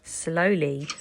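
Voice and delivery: ordinary delivery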